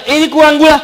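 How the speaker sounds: high, loud mock complaint; complaining husband. Husband griping to wife over coffee, high loud complaint. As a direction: imitate a husband objecting loudly. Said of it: "Suami ngata".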